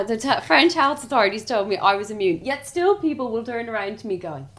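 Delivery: normal talking voice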